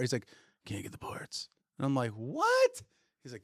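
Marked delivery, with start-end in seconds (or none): 0.7-1.5 whispered, much softer refusal; muttered refusal. Retold or imitated. Repair guy's flat repeated refusal. 2.1-2.8 high, disbelieving yelp; stunned what. Retold or imitated. Disbelief at the repair news.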